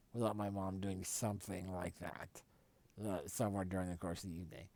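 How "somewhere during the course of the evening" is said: usual speaking voice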